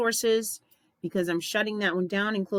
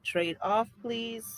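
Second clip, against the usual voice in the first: guided meditation; 4 dB quieter than usual. Acted. Host leading listeners through breathing and spoken statements, guided meditation. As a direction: guide them calmly through each breath.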